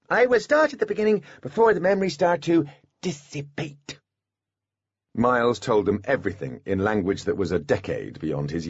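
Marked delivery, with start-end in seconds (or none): 0.1-4.0 put-on child voice. A narrator's reading. Miles child voice.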